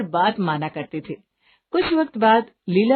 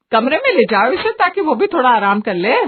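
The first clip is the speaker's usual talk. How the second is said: insistent mother; 4 semitones higher, 6 dB louder than usual. Acted. Mother orders son to help bride.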